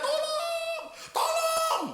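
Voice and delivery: very high thin cry for help; falling woman screaming. Acted. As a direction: shriek for help while falling. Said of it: "dia teriak".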